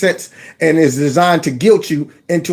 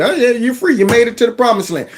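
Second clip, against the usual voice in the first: high, breezy sarcastic reassurance; mock welcome; 7 semitones higher than usual. Host playing official sending migrants onward, high mock welcome. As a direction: wave them through with mock cheer.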